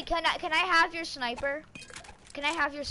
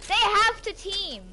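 exasperated; 6 semitones higher than usual. Real, not acted. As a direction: drag it out in disbelief. Complaining about teaming.

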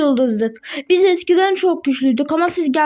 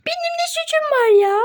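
same protest, pitched much higher; squeaky protest; 12 semitones higher than usual. Acted. Pony repeating her innocence to teammates, much higher squeak. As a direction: squeak it out like a wronged little pony.

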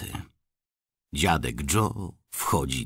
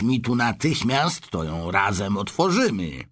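put-on old man, higher, excited and conspiratorial; eager old grandpa; 6 semitones higher than usual. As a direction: grandpa pushing the boy to hurry. Grandpa Joe plots eagerly.

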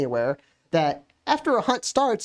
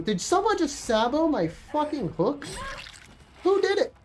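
indignant outcry; 9 semitones higher than usual. Real, not acted. Angry at hook sabotage.

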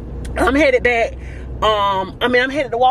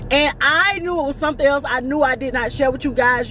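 usual speaking voice

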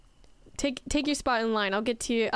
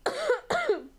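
real laugh, pitched way up; high laugh; 13 semitones higher than usual. Real, not acted. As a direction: break into a high giggle. Laughing at the image.

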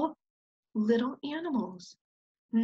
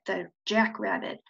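ordinary delivery

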